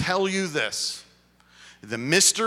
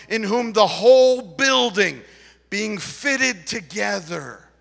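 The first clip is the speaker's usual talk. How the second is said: raised, loud preacher's voice; emphatic scripture proclamation; 6 semitones higher, 9 dB louder than usual. Acted. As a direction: proclaim it high and deliberate, weighting key words. Reads scripture with preaching force.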